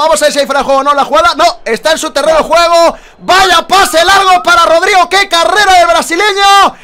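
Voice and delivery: high, loud, rapid play-by-play; excited commentary. Real, not acted. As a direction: call the play at full pitch. Replaying the goal run.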